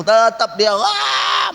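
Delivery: loud, high mock wail; high-pitched imitated shriek; mock possessed cry. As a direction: imitate a possessed woman's outburst.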